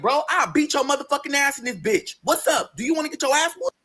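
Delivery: high-pitched retold confrontation; heated threat. Boyfriend challenging a customer to fight, heated.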